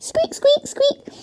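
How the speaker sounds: tiny high mouse squeak, squeak. Shown as a demonstration. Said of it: "mouse pose".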